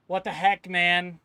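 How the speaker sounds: loud exasperated complaint; annoyed outburst. Real, not acted. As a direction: vent at the game, loudly. Annoyed at being kicked.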